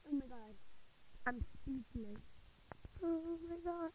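high-pitched, overjoyed gasp of words; thrilled disbelief. Real, not acted. Player stunned at winning the rare item, thrilled. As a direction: let the amazement lift your voice high.

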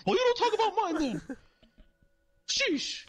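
indignant high, loud clown; Ronald McDonald. Retold or imitated. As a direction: fire back in hammed-up falsetto outrage. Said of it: "And he's like".

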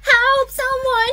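calling for help. Acted.

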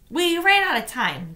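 a hint of a young girl explaining; lightly voiced girl; girl's answer. A narrator's reading. Tamaya answering.

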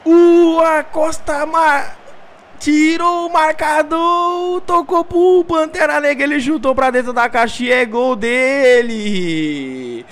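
excited goal replay commentary; play-by-play commentary. Acted. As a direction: describe the replay still fired up.

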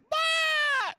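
jumping out of a plane, yelling for his mother; yelling for mama. Retold or imitated. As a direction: scream for your mother mid-fall. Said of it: "I'm like".

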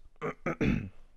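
rough morning throat clear. Real, not acted. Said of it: "I'm sorry for my voice".

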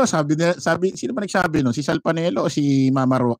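ordinary talk

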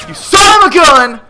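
loud, high-pitched yell at an attacker; angry shout. Real, not acted. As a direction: yell it out as you get shot. Shot at in the game.

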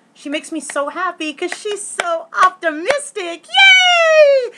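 high gushing that builds into a loud squealed cheer; gleeful gushing. Real, not acted. Fan gushing about the cast member she adores, gleeful. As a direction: build up to a huge, high, drawn-out yay.

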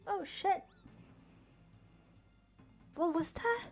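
startled gamer shrieking at an unseen attacker; real scared yelp. Real, not acted. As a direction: yell it in sudden real fright. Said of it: "I have questions and I'm scared".

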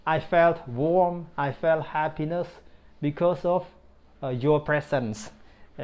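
ordinary talk, nothing marked

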